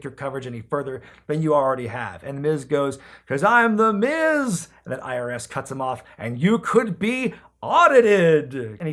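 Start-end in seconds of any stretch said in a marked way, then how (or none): 3.3-4.7 slow, louder, higher smug brag; cocky boast. Retold or imitated. Retelling Miz bragging to Santa, a slow smug boast. 6.2-8.5 slow, louder, put-on menacing taxman; mock threat. IRS warning The Miz, a slow hammed-up threat.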